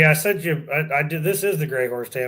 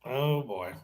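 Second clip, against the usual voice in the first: ordinary talk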